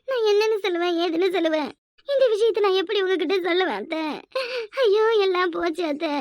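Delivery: put-on sobbing lament; tearful wailing. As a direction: wail to the mother-in-law between sobs. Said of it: "எதுக்கு அழுவுற?". (அழுது புலம்பும் குரல்)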